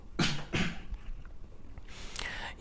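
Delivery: dry real cough. Real, not acted. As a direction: cough through a sore throat. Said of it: "sorry for these coughs".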